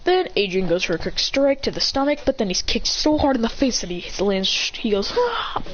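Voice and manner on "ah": very high "ah" with held-back volume; mock pained cry. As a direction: cry out as if kicked, without yelling. Character kicked hard in the face.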